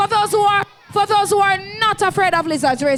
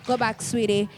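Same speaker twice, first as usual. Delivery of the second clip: gentle and soft; 6 semitones lower, 5 dB quieter than usual. Real, not acted. Soothing a small child.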